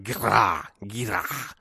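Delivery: lightly imitated toddler grunt on "Gira"; grunted toddler word. Dad imitating his daughter grunting "guitar", a mild grunt. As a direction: grunt the half-word out like a small child. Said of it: "she sort of would grunt that one out too".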